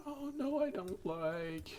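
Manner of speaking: very high, slow squeaky goblin whine. Shown as a demonstration. Streamer showing chat her driving goblin voice, high squeaky goblin. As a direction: whine like a little goblin spotting something. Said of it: "my goblin voice".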